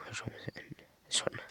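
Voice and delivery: soft unvoiced whisper to himself; whispered aside. Real, not acted. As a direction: mutter it under your breath while searching. Quiet aside searching for shell.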